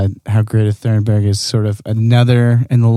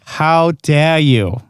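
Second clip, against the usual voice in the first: drawn-out, higher mimicked indignation; mock scolding catchphrase; 4 semitones higher, much slower than usual. Podcaster mimicking a young activist's speech, slow mock indignation. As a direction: stretch it out slowly as a mock rebuke.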